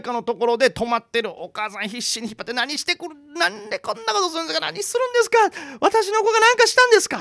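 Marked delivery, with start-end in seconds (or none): 2.5-7.2 rising high desperate pleas; desperate pleading. Retold or imitated. Mother straining in the river begs the old woman, desperate rising pitch. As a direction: plead harder with each line. Mother pulling child from water.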